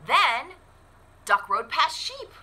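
put-on lively storybook narration for kids; animated picture-book read-aloud; reading to children. A narrator's reading. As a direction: read it like story time at the library.

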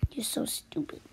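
breathy whisper insult; whispered schoolyard insult. Acted. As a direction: hiss the insult secretly.

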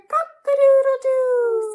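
high, loud rooster call; rooster crow. Acted. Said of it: "says a rooster".

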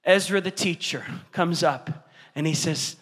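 ordinary talk, nothing marked

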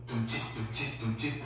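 soft mouthed dance beat, 둠칫; softly beatbox a club rhythm; imitated club beat. (클럽 음악 비트를 작게 입으로 흉내)

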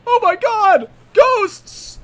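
high, loud scream of fright; terrified shout. Acted. Killer suddenly seeing a ghost, high terrified shout. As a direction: shriek in hammed-up terror.

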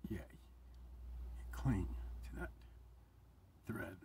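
emotional, breaking into a whisper; choked up whisper. Real, not acted. Vlogger moved by talk of grace, whispering choked up. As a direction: barely get the words out, moved. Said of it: "See how emotional I'm getting about it?".

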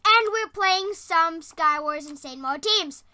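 ordinary talk, nothing marked

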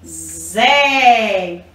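ZÉ drawn out on a long vowel; teacher sounding out a long "Zé"; syllable sound demo. Shown as a demonstration. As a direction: buzz the Z, then hold the É in "Zé".

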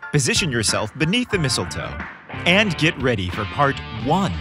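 usual speaking voice

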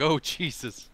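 ordinary talk

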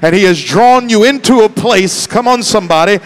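fired-up, shouted exhortation; full-force preaching. Real, not acted. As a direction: preach it out to rouse the congregation.

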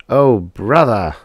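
slow, weary groan of disbelief; exasperated. Acted. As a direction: sigh it out, fed up. Drummond exasperated.

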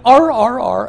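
barking. Retold or imitated. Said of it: "And the thing went".

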